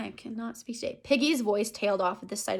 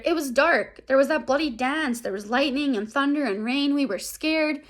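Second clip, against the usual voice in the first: high and louder, pleading excuses; Piggy makes excuses to Ralph, high and pleading; excuse-making Piggy; 5 semitones higher, 5 dB louder than usual. Retold or imitated. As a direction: rattle off the excuses defensively. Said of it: "he's trying to make excuses".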